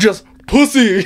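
imitating a friend's outraged rant, high and loud; mock outraged yell. Friend rants at his buddy, mock outraged yell. Retelling movie friend's outburst.

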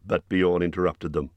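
ordinary delivery, nothing marked

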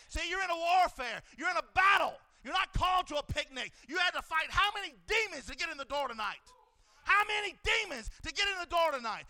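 loud, driving preacher's call; rally them for battle; shouting warfare sermon; preaching at full force. Acted.

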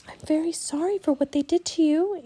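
put-on high, gentle girl's voice; tender childlike apology; soft, tender. A narrator's reading. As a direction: soothe a hurt creature. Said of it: "Annie said softly to the raven".

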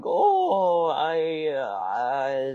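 put-on high, slow doting old French lady; old French woman. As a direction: coo like a sweet old French grandma. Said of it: "It would be like".

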